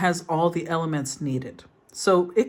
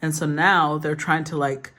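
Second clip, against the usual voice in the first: usual speaking voice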